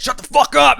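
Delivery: high, loud mock yell; barked order. Officer silencing the band member during a pat-down, barked order. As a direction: act out an officer snapping at the joker.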